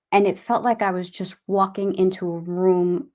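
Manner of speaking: ordinary delivery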